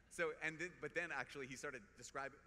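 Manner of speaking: ordinary delivery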